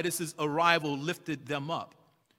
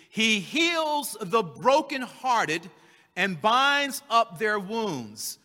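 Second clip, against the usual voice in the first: slow scripture reading; 3 semitones higher, 3 dB louder, much slower than usual. Acted. Reading the psalm verse aloud.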